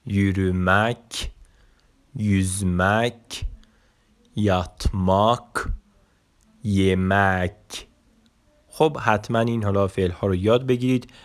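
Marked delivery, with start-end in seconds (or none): 0.1-7.9 slow, drawn-out syllables, a pause after each verb; careful pronunciation demo. Shown as a demonstration. Teacher pronouncing the last verbs once more, slow careful demonstration. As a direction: model each verb slowly for repetition.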